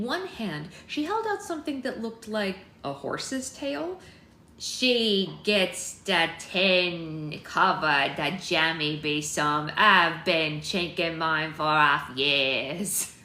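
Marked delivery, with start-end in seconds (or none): 4.6-13.1 slow, loud, heavy attempt at Cockney; intense Cockney accent. A narrator's reading. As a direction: lay on a thick Cockney, drawn out word by word. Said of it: "meant to be a very intense Cockney accent".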